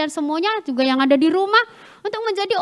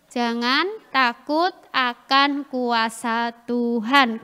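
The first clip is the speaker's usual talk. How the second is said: slow, lower, drawn-out recitation; slow group recital; 5 semitones lower, much slower than usual. Real, not acted. Preacher leads children repeating the last point, slow group recital. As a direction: lead a group word by word.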